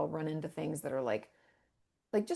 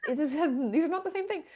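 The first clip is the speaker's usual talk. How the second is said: higher and louder, fast with a laugh; laughing disbelief; 9 semitones higher, 5 dB louder, faster than usual. Real, not acted. Mocking her own people-pleasing, fast laughing disbelief. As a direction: laugh at the absurdity, quick and pitched up.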